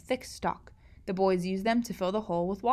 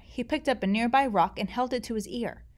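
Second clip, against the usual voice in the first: plain reading voice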